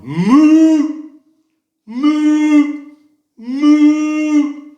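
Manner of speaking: high, booming sustained moo; loud moose call. Acted. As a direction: bellow a long moose call across the plains. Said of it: "they have to be loud".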